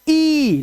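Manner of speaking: ई held long on a high pitch; long vowel. Shown as a demonstration. Teacher recalling the double e sound, long vowel. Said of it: "उच्चारण क्या था".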